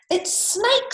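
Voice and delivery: very high storybook mouse; high-pitched announcing voice; chirpy mouse. Acted. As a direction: pipe it up high.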